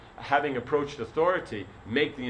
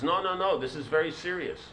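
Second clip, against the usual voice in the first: insistent jester; 6 semitones higher than usual. Retold or imitated.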